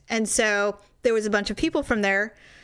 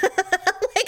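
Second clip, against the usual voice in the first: usual speaking voice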